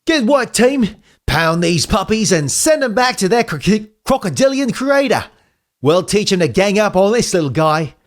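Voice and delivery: rally the team like a cocky wallaby leader; Walt Wallaby. Acted. Leader rallying his team after the fight, in a high cocky voice.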